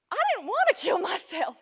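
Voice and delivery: high, loud outburst; emphatic realization. Acted. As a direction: cry out the sudden realization. Sudden realization in dramatic monologue.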